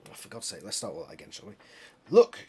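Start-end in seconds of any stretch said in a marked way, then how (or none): 2.1-2.4 much higher, louder urgent cry. Acted. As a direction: call it out sharply to the others. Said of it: "Cassie cried".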